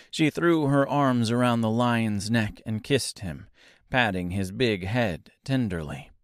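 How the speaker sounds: plain reading voice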